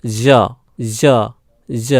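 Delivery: "জ" said as zo with a buzz; half-voiced jo. Shown as a demonstration. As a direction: model "জ" in isolation, z not j. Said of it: "অর্ধস্বর উচ্চারণ হয়".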